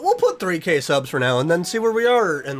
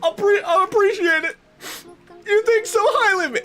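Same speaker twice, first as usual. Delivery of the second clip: much higher, louder, voice breaking near tears; choked-up thanks on the edge of crying; real emotional; 4 dB louder than usual. Real, not acted. Streamer thanking supportive chat, voice cracking with tears. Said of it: "Oh, I'm gonna get emotional".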